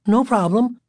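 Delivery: high, timid backing down; meek. A narrator's reading. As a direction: shrink away from the bully as you say it. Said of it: "Michael said meekly".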